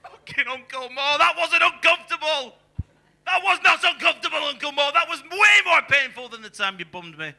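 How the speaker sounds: high, loud pained protest; mock outraged yell. Yelling at Uncle Mo after the painful exam, high loud outrage. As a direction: scold the uncle at the top of the voice.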